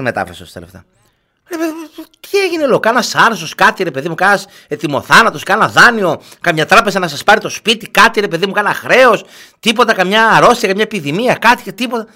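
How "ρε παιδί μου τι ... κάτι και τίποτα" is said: high, rapid worried questions; hammed-up alarm, higher and louder; mock alarm. Host retells asking acquaintance what's wrong, mock alarm.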